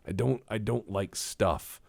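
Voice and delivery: ordinary delivery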